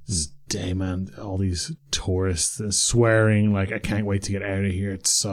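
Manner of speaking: low, slow, quiet grumbling; retold angry grumble. Shop girl complaining about tourists in Croatian, retold angry grumble. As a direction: imitate a fed-up grumble under the voice. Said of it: "saying in Croatian very angrily".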